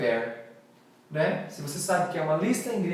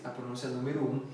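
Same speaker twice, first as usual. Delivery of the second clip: usual speaking voice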